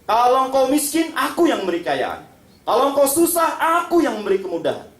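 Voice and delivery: high emphatic quote of the promise; voicing God's promise. Preacher voices God's promise to the congregation, lightly high. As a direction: lift each condition high then fall. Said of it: "lanjutan hadisnya".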